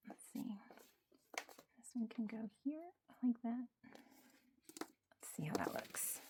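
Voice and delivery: soft whispered aside. Real, not acted. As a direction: murmur it under your breath.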